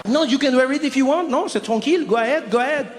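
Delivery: sarcastic French clerk. Retold or imitated.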